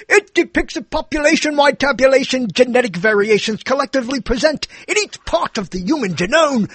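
high-pitched, declaiming stage delivery; like an actor. Shown as a demonstration. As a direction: proclaim it grandly like a Shakespearean actor. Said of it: "imagine if you said it like an actor".

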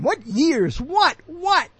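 high, startled repeated what; mock incredulous. Host catching himself on air, mock incredulous. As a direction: pounce on it, pitch jumping up. Querying his own slip, self-heckling.

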